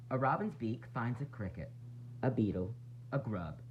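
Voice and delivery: plain reading voice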